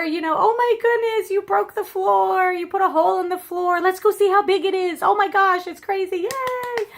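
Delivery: put-on high, delighted cheer for a toddler; cheering mom. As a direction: gush with over-the-top joy like a mom praising a baby. Mom cheering baby's fall.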